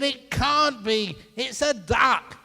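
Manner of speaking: high, loud, drawn-out protest; hammed-up slow, loud contradiction; heated denial. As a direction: shout back as an exasperated husband. Husband's escalating acted quarrel.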